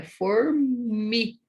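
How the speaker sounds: "formi" slowed, curled-tongue R held; drawn-out American-like R in "formi"; retroflex R. Shown as a demonstration. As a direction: stretch the R and M in "formi". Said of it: "esse som de er".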